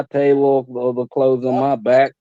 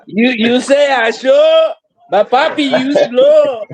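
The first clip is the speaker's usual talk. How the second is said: put-on Spanglish accent; 9 semitones higher, slower than usual. Mock Spanglish taunt, laughing.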